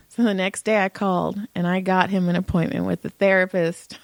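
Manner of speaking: normal talking voice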